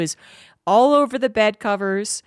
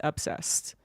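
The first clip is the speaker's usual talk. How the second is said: whispered obsessed; hushed for emphasis; 3 semitones lower, 8 dB quieter than usual. Shown as a demonstration. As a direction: breathe the word obsessed with no voice. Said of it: "She is obsessed".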